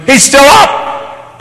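high, loud outraged shout; ballistic mom. Retold or imitated. Mom finds Junior still up and shouts, outraged high yell. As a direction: explode like a mother at the end of her rope. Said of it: "She's ballistic now".

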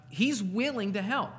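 ordinary delivery

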